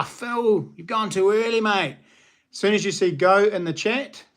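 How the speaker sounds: normal talking voice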